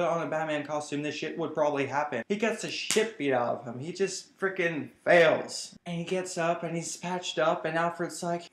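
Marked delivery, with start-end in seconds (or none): none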